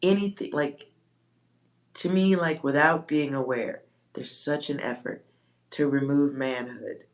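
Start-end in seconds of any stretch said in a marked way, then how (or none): none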